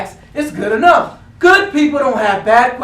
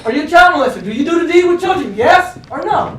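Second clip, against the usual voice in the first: loud, higher, fast cross-examination; interrogating shout; 6 semitones higher, 5 dB louder than usual. Acted. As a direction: grill him like a hostile lawyer.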